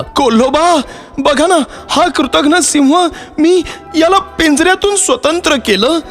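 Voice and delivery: very high-pitched, panicky plea; distraught pleading. A narrator's reading. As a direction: beg the fox for help, stammering near the end. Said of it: "जोर जोरात रडायला लागला". (घाबरून विनवणी, उंच आवाज)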